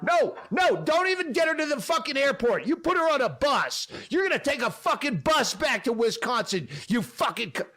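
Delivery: high, fast furious yelling; enraged boss ordering a model sent home; continued screaming. Retold or imitated. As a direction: keep raging, rushing the words. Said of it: "like, continuing".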